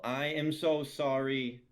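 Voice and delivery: loud slow apology; yelled apology. Acted. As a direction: yell it out, drawn out.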